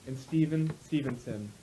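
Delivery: usual speaking voice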